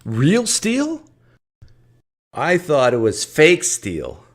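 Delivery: high, loud put-on astonishment; mock amazement. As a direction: feign astonishment at the sales pitch. Mocking the seller's real steel claim.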